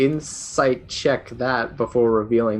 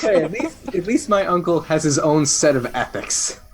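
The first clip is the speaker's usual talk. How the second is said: loud, raised defensive comeback; defensive shout; 7 semitones higher, 7 dB louder than usual. Real, not acted. Player defending his uncle to a friend, loud defensive comeback. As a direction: shout back in your own defence.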